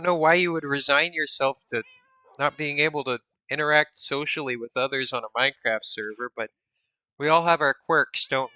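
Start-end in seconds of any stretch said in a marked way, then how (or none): none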